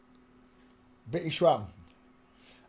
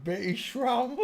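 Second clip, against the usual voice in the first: much higher, laughing through the name; laughing disbelief; 12 semitones higher, 5 dB louder, slower than usual. Real, not acted. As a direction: crack up at the silly name.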